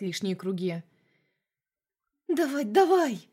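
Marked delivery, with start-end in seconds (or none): 2.3-3.3 Masha growling, louder and higher; impatient growl. A narrator's reading. Masha urges Vlad to hurry, impatient growl. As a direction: urge him on, growling impatiently. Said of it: "рычала моя спутница от нетерпения".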